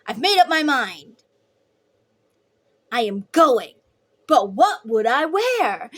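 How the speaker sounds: hammed-up Grinch making up his mind; imitating the Grinch, loud and theatrical; mock Grinch. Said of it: "he's like".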